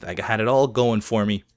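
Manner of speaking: ordinary talk, nothing marked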